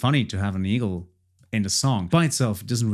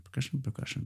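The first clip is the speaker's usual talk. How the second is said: soft murmur; 3 semitones higher, 11 dB quieter, faster than usual. Real, not acted. Producer softly murmuring along to his drum loop.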